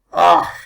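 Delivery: loud grunt of aching joints; pained grunt. A narrator's reading. Old man stumbling down the garden, loud pained grunt. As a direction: grunt as the rheumatism bites. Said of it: "my rheumatism".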